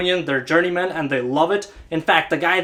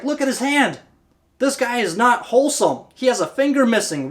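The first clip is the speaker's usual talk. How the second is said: higher-pitched acted alarm; urgent warrior; 6 semitones higher than usual. Warrior showing the tribe the missing finger, urgently. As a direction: point out the flaw insistently, pitched up.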